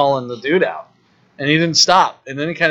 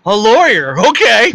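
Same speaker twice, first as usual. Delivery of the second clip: exasperated outburst; 7 semitones higher, 6 dB louder, slower than usual. Real, not acted. Mock-exasperated outburst about lawyers.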